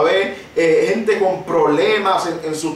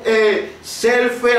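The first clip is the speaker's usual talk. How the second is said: ordinary talk, nothing marked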